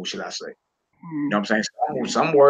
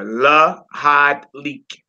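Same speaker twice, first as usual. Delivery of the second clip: Lahad Leak stretched out slowly and clearly; slow teaching model; 4 dB louder, much slower than usual. Shown as a demonstration.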